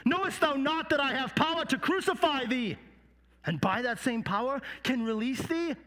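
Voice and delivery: high, indignant interrogation; Pilate. Acted. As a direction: demand an answer from a silent prisoner. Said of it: "And Pilate said".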